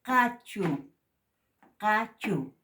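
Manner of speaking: "Cachu" said loudly with a glottal catch on the q; glottal q model. Shown as a demonstration. Teacher modelling the glottal q. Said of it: "Así se pronuncia esto".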